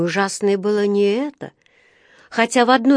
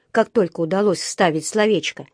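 usual speaking voice

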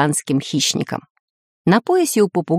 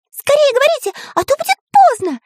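very high, squeaky cartoon voice; urgent little squeal; Карандаш's urgent warning; 3 dB louder than usual. A narrator's reading. Small friend hurries the geographer, urgent warning. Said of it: "предупредил Карандаш".

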